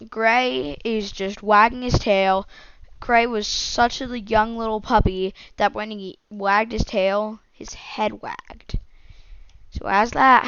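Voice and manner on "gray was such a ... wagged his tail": ordinary delivery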